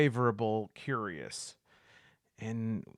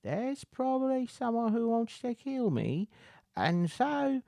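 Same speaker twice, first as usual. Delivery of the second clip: very high, put-on character voice; hint slyly in a high-pitched voice; cagey beggar; 13 semitones higher than usual. Beggar offering to lead the way to treasure, cagey beggar.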